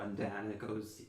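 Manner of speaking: ordinary talk, nothing marked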